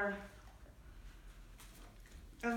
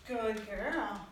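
ordinary delivery

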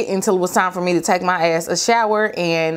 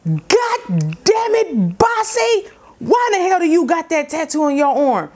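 much higher, slow furious yell; angry dad yelling; 9 semitones higher than usual. Retold or imitated. Father discovering daughter's tattoo, yelling in anger. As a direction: explode at her like a livid father.

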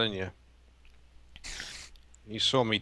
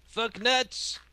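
silly high voice; 12 semitones higher than usual. Pretend.

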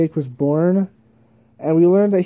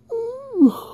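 yawn. Real, not acted. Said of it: "I can't stop yawning".

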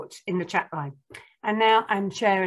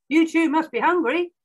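high, loud, hearty greeting; loud, high-pitched fatherly call; Dad calling out; 10 semitones higher, 6 dB louder than usual. A narrator's reading. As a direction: call it to the family, raised and lifted. Dad calls to the family.

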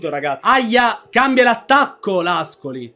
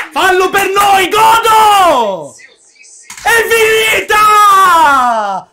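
jubilant shouting; 4 semitones higher than usual. Real, not acted. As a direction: celebrate the final whistle. Final whistle joy.

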